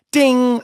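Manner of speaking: high, sung-out bell "ding"; imitated elevator ding. Elevator bell interrupts the actors, imitated high ding.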